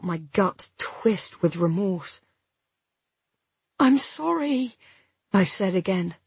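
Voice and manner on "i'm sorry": higher, pleading regret; desperate apology. A narrator's reading. Remorseful woman to injured partner, desperate. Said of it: "more desperately this time".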